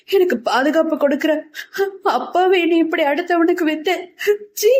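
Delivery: high, tearful outpouring; breaking into sobs. A narrator's reading. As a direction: pour it out in rising distress, crying. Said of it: "குமுறி குமுறி அழ ஆரம்பித்தாள்".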